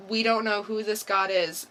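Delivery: usual speaking voice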